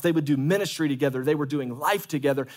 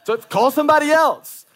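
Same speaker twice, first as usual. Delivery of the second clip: much higher, loud preaching; loud reassurance; 11 semitones higher, 8 dB louder than usual. Real, not acted. Pastor shouting assurance to hesitant members.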